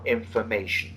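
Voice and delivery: slow and clear. Shown as a demonstration. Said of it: "Slow it down".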